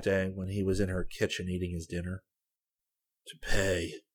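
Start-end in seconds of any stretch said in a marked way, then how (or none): 3.3-4.0 harsh and severe. A narrator's reading. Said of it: "he said in a harsh voice".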